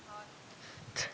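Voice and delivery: ordinary talk, nothing marked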